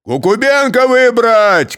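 loud, slow, high yell; shout. A narrator's reading. Part of the crowd shouting for Kukubenko, loud. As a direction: call out the name for the crowd. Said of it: "кричала часть".

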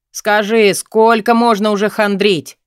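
loud, high exasperation; blurted out. A narrator's reading. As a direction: fire the question off, fed up. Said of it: "выпалил он".

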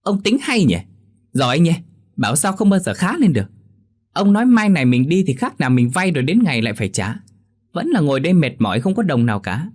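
high, loud angry scolding; snapping wife. Acted. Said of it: "Mộ Oanh lại gắt".

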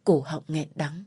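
ordinary delivery, nothing marked

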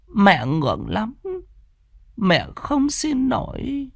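high, aged mother's voice, pleading, slowing and softening at the end; an old mother reasoning with her son, trailing off ashamed. Acted. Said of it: "Nhìn con mà tức giận". (giọng bà mẹ già, cao, khuyên nhủ rồi chậm và nhỏ dần)

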